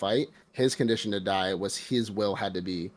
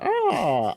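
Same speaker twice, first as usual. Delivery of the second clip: cackling bird laugh; 13 semitones higher, 6 dB louder than usual. Retold or imitated. Crow laugh imitated.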